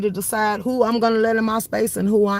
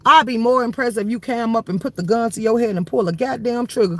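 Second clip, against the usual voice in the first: ordinary talk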